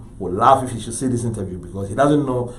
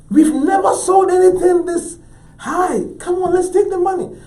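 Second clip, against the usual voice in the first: very high, loud exasperated pleading; desperate plea; 6 dB louder than usual. Retold or imitated. Broke artist silently begging his dealer, frantic and high. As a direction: beg at the top of the voice.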